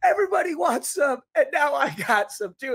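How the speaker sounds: high, loud-edged victory quote; re-enacted triumph. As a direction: crow the song lyric like you just won. Re-enacting triumphant lyric after winning.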